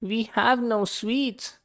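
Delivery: high, slow little ants; ants' voice. A narrator's reading. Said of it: "the ants replied".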